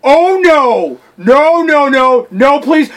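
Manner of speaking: high, loud pleading wail; hammed-up desperate begging; mock dismay. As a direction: act out a panicking gamer. Voicing the player's dismay.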